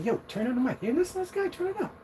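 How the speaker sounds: very high, softened mock call; high-pitched acted aside to friends; imitated eager listeners. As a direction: urge your buddies in a small, high put-on voice. Acts out listeners calling each other.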